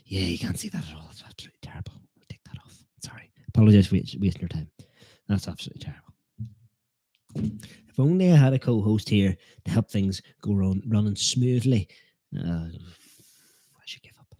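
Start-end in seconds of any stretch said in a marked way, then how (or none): 1.1-3.3 embarrassed whispered aside. Real, not acted. 5.3-6.1 whispered self-criticism. Real, not acted. Repeats embarrassed aside. 12.3-14.3 slow whispered surrender; defeated whisper. Real, not acted. Defeated aside.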